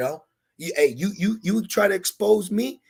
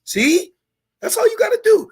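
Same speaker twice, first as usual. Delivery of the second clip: way up high and loud, fired up; excited preaching; 8 dB louder than usual. Real, not acted. Preacher to the congregation, an excited high loud burst.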